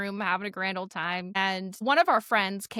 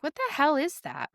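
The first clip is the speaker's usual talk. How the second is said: higher-pitched mock startled question; puzzled alarm, pitched up; retold alarm; 6 semitones higher than usual. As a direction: act out being baffled by a strange noise. Friends hear tapping, retold reaction.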